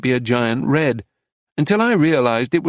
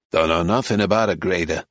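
lower, sneering denial; a touch of an angry sneer, lower; 6 semitones lower than usual. A narrator's reading. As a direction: spit out the denial with contempt. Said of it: "Clarence sneered angrily".

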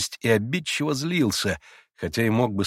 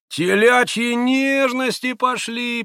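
higher, slow mocking tease; teasing; 14 semitones higher, 4 dB louder, slower than usual. A narrator's reading. Rakitin teases Grushenka and Alyosha, mocking. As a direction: taunt them sweetly. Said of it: "поддразнил Ракитин".

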